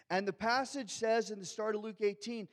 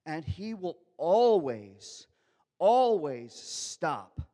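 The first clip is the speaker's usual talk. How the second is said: always, always stop said slow and louder; emphatic; 7 dB louder, much slower than usual. Real, not acted. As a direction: lean hard on "always" both times, slowly. Preacher drives home point.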